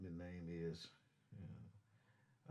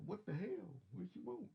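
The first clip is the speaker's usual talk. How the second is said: high mock-annoyed assistant voice; Siri talking back; 10 semitones higher than usual. Trucker voicing Siri as rude, mock-annoyed high voice. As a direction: play the phone snapping back, pitched up. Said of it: "and she go".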